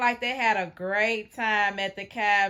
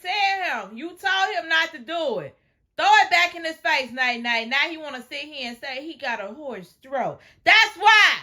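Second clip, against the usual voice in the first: excited shouting; 5 semitones higher, 4 dB louder than usual. Real, not acted. Egging her on, loud.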